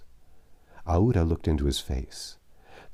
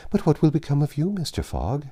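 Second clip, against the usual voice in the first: anxious concern; 9 semitones higher than usual. A narrator's reading. Aouda anxious for Fogg.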